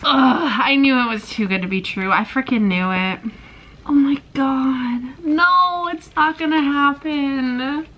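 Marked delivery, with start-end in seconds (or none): 3.9-7.9 high, slow, despairing wail; anguished. Real, not acted. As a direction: drag the words out in despair. Despair at plot turn.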